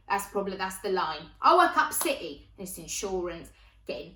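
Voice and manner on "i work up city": loud, higher mimicked boast; city-worker catchphrase. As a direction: announce it like a man's stock line. Repeats the stock line.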